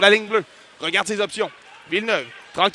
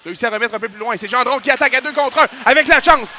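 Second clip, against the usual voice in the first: normal talking voice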